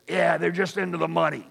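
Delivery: cynical neighbor. Retold or imitated. A neighbor's imagined cynical comment.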